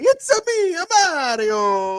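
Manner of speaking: squeaky Italian plumber impression; Mario voice. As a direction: ham up a video game plumber. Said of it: "very stereotypically Italian tenor".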